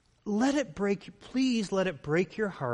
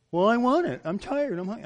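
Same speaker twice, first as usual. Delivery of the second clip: put-on higher, louder childish whine; whining child; 5 semitones higher, 5 dB louder than usual. A child whining at dad for a drink, high and loud. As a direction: complain like a tired kid. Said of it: "you're whining".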